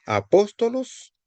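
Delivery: "apóstolos" drawn out slowly, stressed on PÓS; slow teacher's model of "apóstolos", accent on second syllable; stress on antepenult. Shown as a demonstration. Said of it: "sobre la antepenúltima sílaba".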